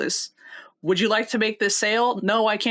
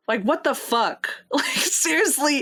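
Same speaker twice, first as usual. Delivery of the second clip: laughing disbelief; 5 semitones higher, slower than usual. Retold or imitated. Mocking disbelief about hundred-dollar bills.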